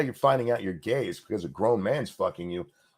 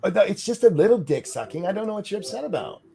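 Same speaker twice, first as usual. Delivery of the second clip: mock dismissive; 9 semitones higher than usual. Voicing dismissive book defenders.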